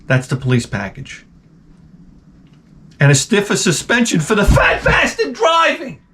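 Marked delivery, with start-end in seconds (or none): 3.0-6.0 laughing shout. Acted. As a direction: bellow the punchline through a laugh.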